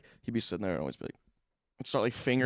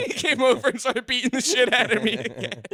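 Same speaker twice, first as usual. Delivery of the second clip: high, loud, squealing through real laughter; helpless laughing; 13 semitones higher, 4 dB louder than usual. Real, not acted. Storyteller cracks up at his own punchline, helpless laughing. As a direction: crack up while getting the words out.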